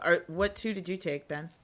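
normal talking voice

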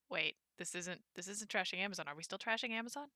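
softly acted-out dawning doubt; hushed, uncertain double-take; quiet confusion. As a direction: play mild bewilderment, very softly. Acting out slow dawning confusion.

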